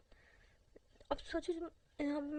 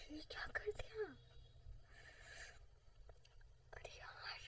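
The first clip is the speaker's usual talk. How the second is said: whisper; 2 semitones higher, 5 dB quieter, slower than usual. Acted.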